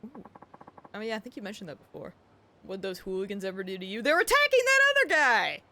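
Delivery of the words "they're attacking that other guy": shouting. Real, not acted. Sudden alarmed outburst.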